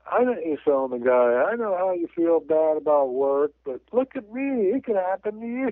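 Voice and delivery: lightly acted movie quote, pitch climbing high; high-pitched mock reassurance; movie quote impression. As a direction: replay the line with a rising, upbeat lift. Acting out smiling braced movie character.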